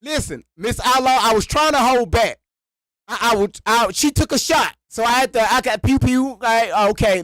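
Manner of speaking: hurried, rattled pleading; flustered. Real, not acted. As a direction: scramble to explain. Flustered apology to co-host.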